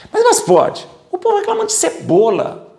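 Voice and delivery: can you believe it, squeal; preacher mocking the people, squealing in disbelief; incredulous. Real, not acted. Incredulous outburst.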